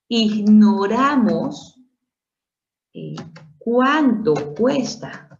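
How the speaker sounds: drawn-out stress on cuánto; slow emphatic demo. Shown as a demonstration. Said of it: "Ignoramos cuánto cuesta".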